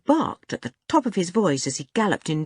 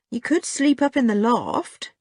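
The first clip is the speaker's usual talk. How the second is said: hesitant, high-pitched suggestion; rather doubtful; 7 semitones higher than usual. A narrator's reading. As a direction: offer it without conviction. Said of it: "sounding rather doubtful".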